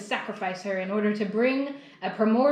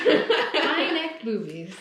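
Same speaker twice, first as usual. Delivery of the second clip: laughing; 4 semitones higher, much slower than usual. Real, not acted. Repeating a funny phrase.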